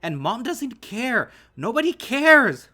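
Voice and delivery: high, very loud, drawn-out anguished screams; screaming meltdown. A narrator's reading. Son's screaming breakdown at father.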